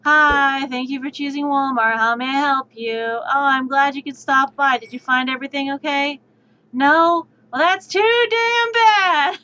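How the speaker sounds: mock-cheerful retail greeting that turns snide; tired bored cashier. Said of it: "just be like".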